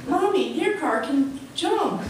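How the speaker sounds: put-on high, slow little kid; a little kid in the back seat points at the car, high and slow. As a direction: squeak it out like a small child.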